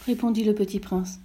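ordinary delivery, nothing marked

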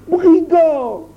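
very high, strained exclamation; outraged cry. A narrator's reading. Barter hearing he must be executioner, crying out. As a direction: burst out in panicked outrage. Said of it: "s'écria-t-il".

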